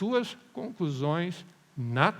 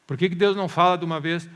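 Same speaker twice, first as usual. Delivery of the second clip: loud, exasperated mock question; raised-voice impatient questioning; impatient demand; 8 dB louder than usual. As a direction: demand an answer like a fed-up believer.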